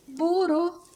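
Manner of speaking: Boro? squeaked very high and loud; incredulous echo. Acted. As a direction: repeat Boro? in shrill disbelief. Echoes the word in disbelief.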